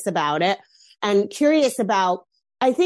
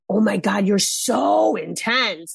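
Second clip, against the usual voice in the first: drawn-out "so", imitating critics; lightly hammed-up complaint; mock exasperation; much slower than usual. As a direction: stretch it out like an overwhelmed onlooker. Mimics people calling her intense.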